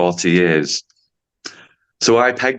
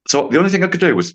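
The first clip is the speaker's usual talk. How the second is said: ordinary talk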